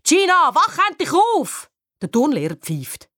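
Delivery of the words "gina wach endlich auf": high, angry shout; furious high-pitched holler; exasperated yell. A narrator's reading. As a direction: yell at a dozy teammate to wake up. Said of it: "Jetzt werde ich echt verrückt".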